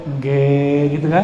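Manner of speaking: Engge slow and drawn, Javanese style; Javanese yes. Shown as a demonstration. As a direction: slowly say Engge as a Javanese would. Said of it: "saya pakai bahasa Jawa deh".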